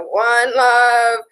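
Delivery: lightly voiced easygoing reggae singer; a hint of reggae-star impersonation. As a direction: pitch it up into a mellow slogan. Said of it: "[name] mentality where".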